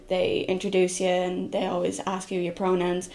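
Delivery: ordinary delivery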